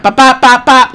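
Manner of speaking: loud, high popping punches; imitated punch sounds. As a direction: punch out each pop loudly. Imitates punches landing in fight.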